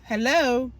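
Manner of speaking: ordinary talk, nothing marked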